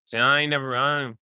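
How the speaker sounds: ordinary talk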